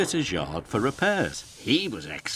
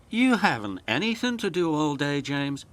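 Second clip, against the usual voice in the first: lightly wheezing Emily; 6 semitones higher, faster than usual. A narrator's reading. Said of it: "wheezed Emily".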